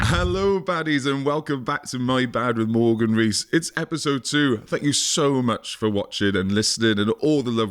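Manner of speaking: upbeat show intro read; podcast host intro. Acted. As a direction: welcome the audience like a host.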